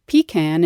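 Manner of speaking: "pecan" as PEE-can, stress up front; New England pronunciation. Shown as a demonstration. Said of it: "we have some regional tendencies".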